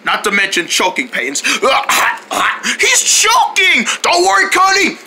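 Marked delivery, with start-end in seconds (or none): 1.4-5.0 high, urgent exclamation; alarmed rescue cry. Acted. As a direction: call out in an emergency. Friend chokes, rescuer calls out.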